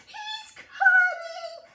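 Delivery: very high, loud, slow warning shriek; panicked shout. Acted. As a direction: scream the warning. Giantess warns Jack.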